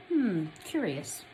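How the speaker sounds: usual speaking voice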